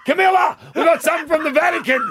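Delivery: high, loud shout, fast at the end; excited calling out. Pretend. Charles calling Camilla about the Vatican gift, excited shout. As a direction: yell the big news to someone in another room.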